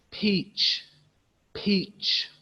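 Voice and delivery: peach high with a drawn-out long E; peach, second one whispered; long vowel demo. Shown as a demonstration. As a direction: stretch the EE in peach. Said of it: "Long or short".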